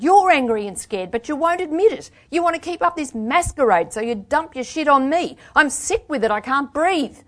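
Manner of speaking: high, loud torrent of blame; angry shouting. A narrator's reading. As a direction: keep shouting the accusation, at breaking point. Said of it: "I shouted".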